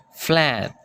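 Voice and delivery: flat with a British open a, flaat; British accent. Shown as a demonstration. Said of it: "kalau orang Inggris dia akan mengatakan". (aksen British pada flat)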